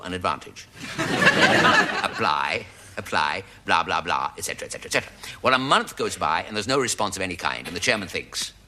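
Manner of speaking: ordinary talk, nothing marked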